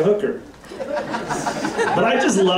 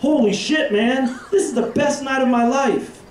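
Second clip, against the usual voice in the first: much higher, giddy winner's exclaim; thrilled; 10 semitones higher than usual. Retold or imitated. Telling his buddy about the roulette win, excited and high. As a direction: gush like you just hit big. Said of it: "I'm like".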